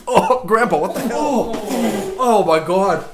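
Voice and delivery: high, loud disgusted exclamations; disgusted outcry. Real, not acted. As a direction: cry out in revulsion. Reacting to terrible taste.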